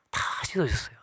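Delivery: whispered, hushed; reverent whisper. Real, not acted. Preacher whispering to the congregation about Jesus's service. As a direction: let it fall to a whisper. Jesus washing disciples' feet.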